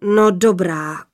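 slow, grudging sigh of assent; big voice relenting, drawn out; sighing giant father. A narrator's reading. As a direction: give in heavily as the fearsome father. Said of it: "vzdychl hrozitánský hlas".

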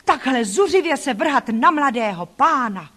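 high, scandalised outcry; loud indignant cries, easing off toward the end; shocked exclamation. A narrator's reading. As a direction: exclaim in outrage at the child's behaviour. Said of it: "jak vykřikli".

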